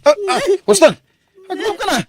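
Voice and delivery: high, loud cries; panicked high scream; screaming for help. Acted. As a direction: scream for someone to come. Husband crying for help.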